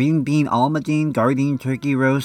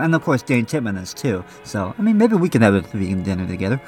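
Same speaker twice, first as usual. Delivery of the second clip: normal talking voice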